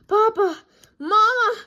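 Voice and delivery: high, loud gasping cry of the cubs; breathless excited cry. Acted. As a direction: burst in calling for Papa and Mama. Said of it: "they gasped".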